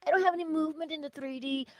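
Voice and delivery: high-pitched fretting complaint; mock panic. Teacher mimicking a student who sees no results, mock panic. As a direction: imitate someone panicking, pitched well up. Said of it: "you don't have to panic".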